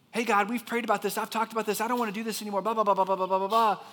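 high-pitched griping run-on rant; mock whiny prayer, tumbling into loud blahs; launching into complaint. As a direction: rattle off a fed-up complaint. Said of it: "he just launches in, just like we do".

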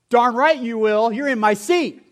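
hammed-up fan defending his seat; mock indignant shout. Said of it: "That's not what I said".

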